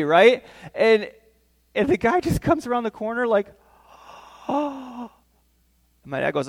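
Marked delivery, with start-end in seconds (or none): 4.5-5.1 airy gasp into a higher oh; shocked gasp. Acted. Builder coming round the corner to the damage, shocked gasp. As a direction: gasp as if caught out. Builder sees his smashed work.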